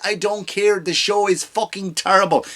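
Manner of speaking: ordinary talk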